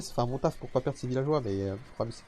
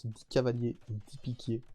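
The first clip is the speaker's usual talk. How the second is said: ordinary talk, nothing marked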